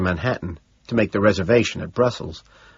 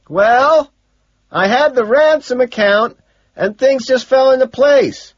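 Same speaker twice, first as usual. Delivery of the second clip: sustained high yell; loud and high throughout; shouting; 8 dB louder than usual. A narrator's reading. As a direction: holler the answer over a din. Shouted exchange at a concert.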